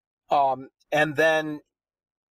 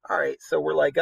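ordinary talk

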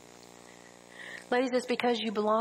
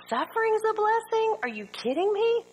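mock disbelief; 12 semitones higher than usual. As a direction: voice jumps much higher in mock outrage. Mock listener disbelieving suffering blessing.

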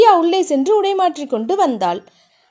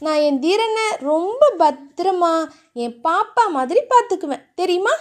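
put-on doting girl; 5 semitones higher than usual. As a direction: promise tenderly like to a baby. Narrator voices Thiya.

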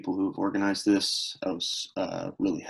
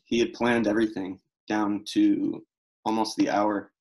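normal talking voice

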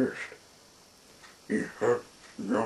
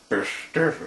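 higher, louder storybook command; crying out; 4 semitones higher, 7 dB louder than usual. A narrator's reading. He commands the spirits to be still, crying out. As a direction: cry out the order firmly. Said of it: "he cried".

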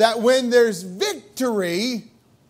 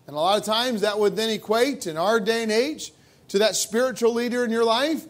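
ordinary talk

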